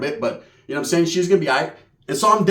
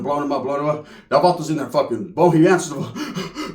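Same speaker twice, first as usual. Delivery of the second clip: ordinary delivery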